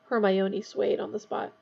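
ordinary delivery, nothing marked

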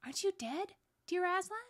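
put-on wondering little girl; astonished. A narrator's reading. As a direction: ask in disbelief, voice lifted high. Lucy astonished Aslan is alive.

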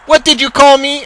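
offended coach demanding an answer; angered outrage. A narrator's reading. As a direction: cry out, stung by the insult. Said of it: "perturbed and angered by the statement".

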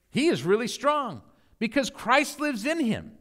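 ordinary delivery

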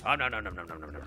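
panicked. Real, not acted. Snakes approaching in game.

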